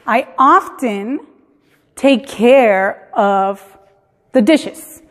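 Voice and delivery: slow, drawn-out "often" with the T sounded; stressed often. Shown as a demonstration. Teacher showing emphasis on "often" to students, slowly. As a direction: lean on "often" and stretch the phrase. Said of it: "si quieren hacer énfasis".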